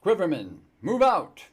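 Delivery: loud, high battle order; commanding shout. Acted. Commander ordering his men to move out, shouting. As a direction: bark the order to march.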